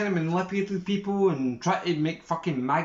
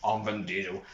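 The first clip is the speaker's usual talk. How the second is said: much lower, softer Vin Diesel voice; Vin Diesel impression; 10 semitones lower, 7 dB quieter than usual. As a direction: drop the voice way down and murmur the name like Vin Diesel. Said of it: "this is my impression of everybody".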